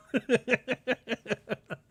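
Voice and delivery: high-pitched staccato giggle; rapid string of high laughs; high chuckle. Real, not acted. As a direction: laugh in quick, high bursts. Laughs after his host impression.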